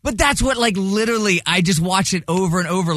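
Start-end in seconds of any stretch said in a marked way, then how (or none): none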